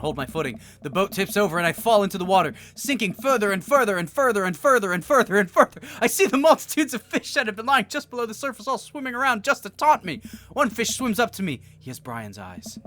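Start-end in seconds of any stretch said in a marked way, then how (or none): 0.8-11.6 rising, louder, more urgent; building excitement. A narrator's reading. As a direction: narrate the fall with mounting panic. Dream sinking, rising excitement.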